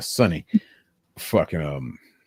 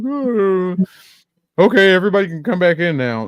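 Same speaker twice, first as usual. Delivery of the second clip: high, fast sarcastic official voice; mock announcement; 10 semitones higher, 5 dB louder, faster than usual. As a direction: parody a school announcement. Mocking the evacuation all-clear.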